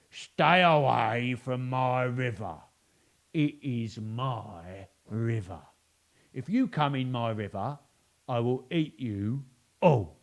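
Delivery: slow, loud, bossy crocodile; greedy crocodile bellowing at the forest creatures; crocodile shouting. Acted. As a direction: warn them off your river, slowly. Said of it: "he shouted to the creatures".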